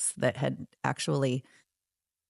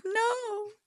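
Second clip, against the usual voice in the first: tiny, high mock whimper; imitating a soft, drawn-out wail; retold tearful no; 5 dB quieter than usual. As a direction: squeak it out as if crying. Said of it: "I was really upset, crying on his couch".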